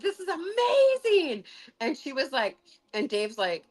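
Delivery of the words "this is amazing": very high, drawn-out exclamation; amazed delight. Retold or imitated. Healer hearing the woman is cured, amazed high squeal. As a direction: stretch it out in high-pitched wonder. Retold amazement at cancer cure.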